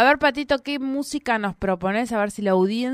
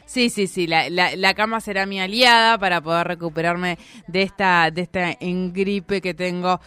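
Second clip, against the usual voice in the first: ordinary talk, nothing marked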